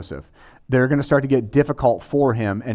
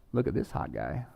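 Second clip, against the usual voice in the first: acted wife's private thought, much softer and lower; murmured low, as the wife eyeing someone; quiet admiring aside; 8 semitones lower, 13 dB quieter than usual. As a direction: drop to a low, hushed aside to oneself. Acting out the bored wife's thought.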